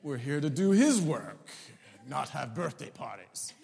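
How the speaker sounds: loud, weighty mock-pastoral pronouncement; pompous seminary student. Seminary student correcting his wife, mock-pompous and loud. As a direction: lecture grandly, leaning on each phrase. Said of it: "Being the astute future pastor biblical student".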